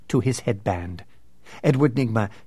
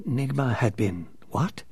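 usual speaking voice

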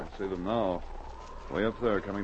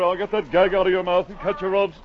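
ordinary delivery

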